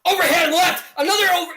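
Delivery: high, loud burst at a landed punch; excited commentary shout. Acted. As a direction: erupt as the punch lands. Big punches land in commentary.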